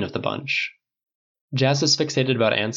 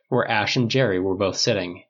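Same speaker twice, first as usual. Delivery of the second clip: usual speaking voice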